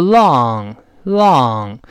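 "long" held slowly, twice with a gap; drawn-out long. Shown as a demonstration. Teacher showing learners the lengthened word, "long" drawn out. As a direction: stretch the vowel of "long" out. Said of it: "读的也要拉长一些".

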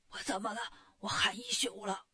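low, soft, worn-out voice; hoarse. A narrator's reading. Wukong explains his lost voice to the demon king, hoarse. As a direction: complain with no voice left. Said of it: "你嗓子怎么了".

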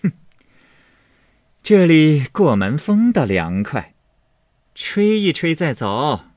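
a short huff, then loud, high and breezy; amused. Acted. Mistress pausing in the draughty doorway, loudly amused. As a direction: throw it out loudly with a laugh in it. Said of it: "笑道".

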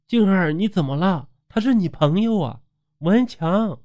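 high, urgent acted cry. A narrator's reading. Friends pleading with possessed Jing'er, crying out in alarm. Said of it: "抓住他的手大叫".

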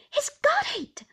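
very high, loud cry of alarm; high-pitched horrified exclamation. A narrator's reading. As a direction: cry out in panic. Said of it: "cried Miss Murdstone".

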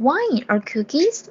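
wine or cookies with a rising lift at the end; rising question tune. Shown as a demonstration. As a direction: ask wine or cookies slowly, tune going up. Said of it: "所以要升调".

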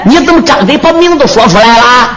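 ordinary delivery, nothing marked

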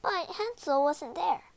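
usual speaking voice

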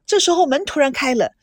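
ordinary delivery

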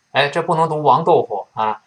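ordinary talk, nothing marked

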